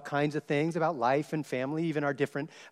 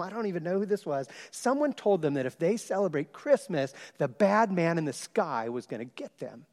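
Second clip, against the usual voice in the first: usual speaking voice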